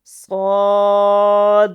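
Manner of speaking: very slow loud drawn chanting of the letters; model recitation. Shown as a demonstration. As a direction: stretch every مد long and full. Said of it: "اسے موٹا بھی پڑھنا ہے".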